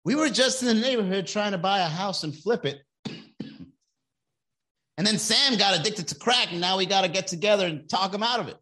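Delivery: lightly voiced worried family; a hint of earnest confession; Intervention family. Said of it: "episode of Intervention".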